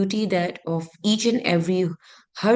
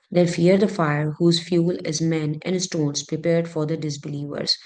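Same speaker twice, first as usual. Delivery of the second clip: ordinary talk, nothing marked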